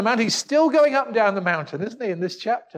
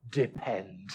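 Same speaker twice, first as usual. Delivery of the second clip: hushed word; 12 semitones lower, 10 dB quieter than usual. Shown as a demonstration. Preacher murmuring the key word to his congregation. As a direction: drop almost to a whisper, much lower and softer.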